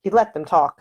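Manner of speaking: plain reading voice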